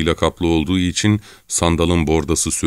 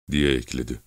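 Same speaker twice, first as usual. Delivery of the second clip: ordinary delivery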